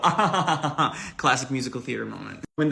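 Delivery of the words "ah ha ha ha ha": staged theatrical laugh; mock chorus laugh. Riders laughing together at the driver's joke.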